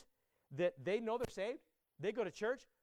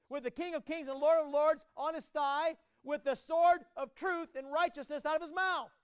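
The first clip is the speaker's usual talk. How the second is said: louder, higher, rousing sermon climax; full-force preaching; 4 semitones higher, 6 dB louder than usual. Acted. Pastor rousing the congregation, at full force. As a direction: preach it with mounting fire.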